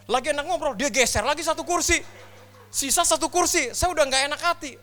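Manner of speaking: high, loud mounting panic; comic alarm. Retold or imitated. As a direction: tell it as if danger is closing in. Acts rising alarm as chair gap closes.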